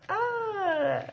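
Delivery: ordinary delivery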